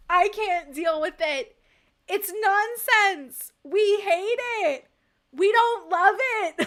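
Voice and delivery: slow and emphatic, hammering the point home; loud, slow fed-up outburst; loud ranting. Real, not acted. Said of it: "I am done, uh, ranting".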